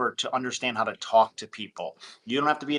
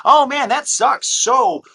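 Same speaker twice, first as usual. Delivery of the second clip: tone-deaf salesman; 10 semitones higher, 6 dB louder than usual. Retold or imitated. Caller ignoring a seller's divorce, high, loud fake sympathy. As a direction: brush past his pain into the pitch. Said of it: "you're like".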